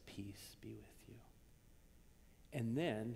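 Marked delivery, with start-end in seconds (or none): 0.1-1.1 much softer, lower, whispered. Retold or imitated. Jesus greeting frightened disciples, whispered. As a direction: breathe "Peace be with you" as a hush. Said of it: "and he says".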